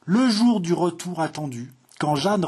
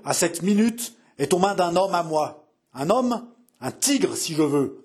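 menacing threat; 3 semitones higher than usual. Acted. Kidnapper explaining the death signal to mother, menacing threat.

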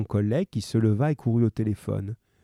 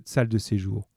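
plain reading voice

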